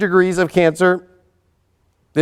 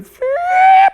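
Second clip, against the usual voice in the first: short squealing laugh; a sudden high-pitched giggle; 5 dB louder than usual. Real, not acted. Giddy burst mid-sentence.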